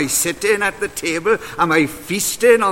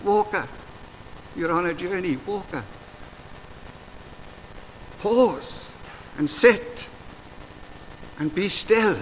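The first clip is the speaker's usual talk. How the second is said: gentle reassurance; 3 semitones lower than usual. Acted. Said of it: "if I can borrow Seamus Heaney's words".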